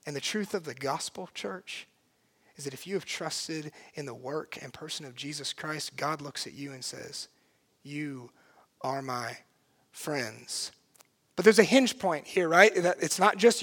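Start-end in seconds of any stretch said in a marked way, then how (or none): 0.1-10.7 low, very soft preacher's voice; hushed, earnest preaching. Acted. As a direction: bring it right down to a tender hush.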